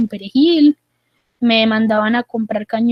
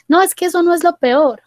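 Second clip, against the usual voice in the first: higher-pitched, emphatic acted protest; insistent objection; 6 semitones higher, 4 dB louder than usual. Patient tells psychologist it gets worse, insistent. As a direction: cut off the comfort, insisting.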